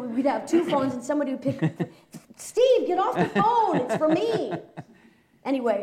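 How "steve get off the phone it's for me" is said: high, loud yell with a laugh, much higher than her own voice; sibling yelling. Retold or imitated. Kid yelling at brother Steve over the phone, high and loud. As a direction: shout at your brother to get off the phone.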